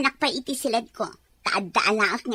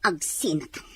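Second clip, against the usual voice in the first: whisper; 6 semitones lower, 5 dB quieter than usual. Acted. Wife whispering after quarrel.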